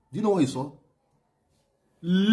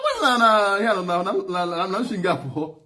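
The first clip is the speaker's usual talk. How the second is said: teasing reply; 3 semitones higher than usual. Retold or imitated. Mocking reply to leader's call.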